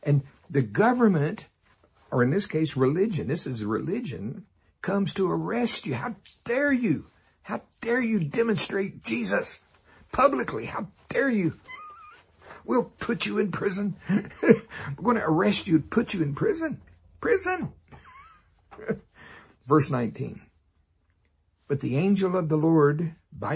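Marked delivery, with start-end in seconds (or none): 6.0-17.7 high, indignant mock outrage at the believers; mock outraged official. Preacher mocking religious leaders, high indignant outrage. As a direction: play the scandalised religious leader, pitched up high.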